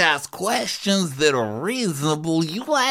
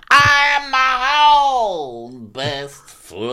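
loud, slow, tearful cry; dramatic mock shout; 4 semitones higher, 4 dB louder, much slower than usual. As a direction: wail it like a melodrama. Mocking a dramatic declaration.

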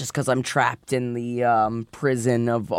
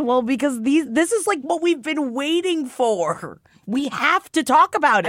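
high excited; 11 semitones higher than usual. Real, not acted. Excited about the chapter.

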